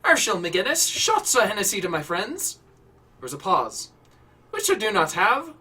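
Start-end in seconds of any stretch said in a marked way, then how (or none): 0.0-2.6 lightly Irish, higher voice; a touch of Irish bar owner; Irish accent. Acted. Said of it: "An Irish accent filtered through". 4.5-5.5 lightly Irish, higher voice; a touch of Irish bar owner; Irish accent. Acted. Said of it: "An Irish accent filtered through".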